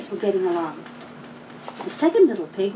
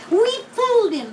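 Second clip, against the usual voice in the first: much higher, louder gleeful cry; gleeful pigs; 12 semitones higher, 4 dB louder than usual. A narrator's reading. Pigs think they fooled the wolf, high gleeful cry. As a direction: crow with delight. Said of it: "Laughed the two little pigs".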